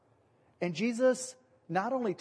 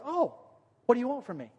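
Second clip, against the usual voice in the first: higher, playful exasperated sigh; mock exasperation; 6 semitones higher, faster than usual. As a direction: sound fondly put upon. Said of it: "and Jesus goes".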